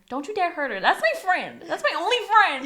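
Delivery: high, rising mock outrage; indignant protest. Acting out an indignant defence.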